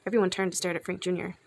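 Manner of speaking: plain reading voice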